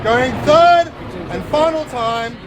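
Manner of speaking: loud, rising going once, going twice; auctioneer call. Real, not acted. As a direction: call the bids out to the crowd. Auctioneer calling the bids.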